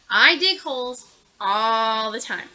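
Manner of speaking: loud, slow know-it-all mole; grumpy mole voice. Acted. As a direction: lay it down like a grumpy expert.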